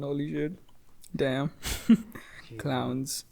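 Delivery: ordinary talk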